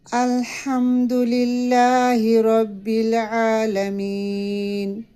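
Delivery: slow, drawn-out tajweed recitation; measured Quranic chant. Shown as a demonstration. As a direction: chant each word carefully. Recites verses as model.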